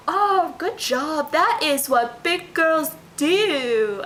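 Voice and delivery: put-on high, slow, sing-song baby talk. Shown as a demonstration. Mom praising her toddler in her weird baby talk accent. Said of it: "So the way I'll say it is like".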